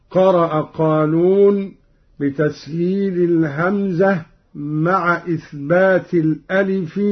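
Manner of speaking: ordinary talk, nothing marked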